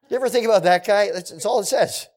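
lively wondering aside; animated aside. Real, not acted. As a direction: marvel at it, speeding up. Preacher marvels at shield bearer.

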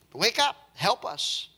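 lightly acted, slow wake-up call; mild imitation of men rousing a sleeper; pleading pagans.

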